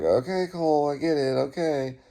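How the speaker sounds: a hint of a patient mother brushing him off; mom's weary reply. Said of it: "And my mom's like".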